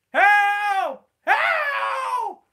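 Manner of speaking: very high, loud hammed-up scream; screaming for help. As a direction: scream while laughing. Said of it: "Steamed Ham script".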